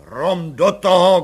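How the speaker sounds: loud, slow shouted order. A narrator's reading. Commissioner shouting at the mother, loud order. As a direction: bellow at her to get on with it. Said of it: "křikl komisař".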